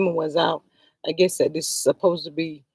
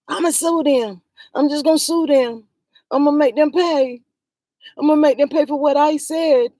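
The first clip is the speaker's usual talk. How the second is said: higher, louder sneering imitation of him; mock Maurice; 5 semitones higher, 5 dB louder than usual. Vlogger mimicking Maurice vowing to sue creators, higher and louder. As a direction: mimic the husband's whiny threat to sue, mocking.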